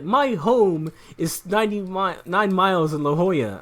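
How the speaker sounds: imitated yelling customer. Customer protesting to clerk about distant home, mock yelling. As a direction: retell an outraged customer spelling it out. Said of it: "he's yelling at me".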